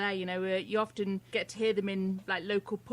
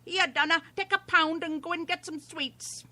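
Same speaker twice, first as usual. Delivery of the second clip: higher-pitched imitated Irish granny; Irish grandma; 7 semitones higher than usual. Imitating her tiny grandma, high Irish granny voice. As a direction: put on an Irish grandmother's accent. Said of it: "I had, like, an Irish grandma".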